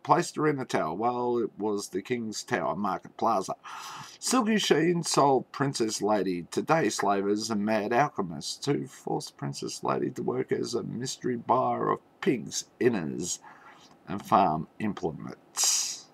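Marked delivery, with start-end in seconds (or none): none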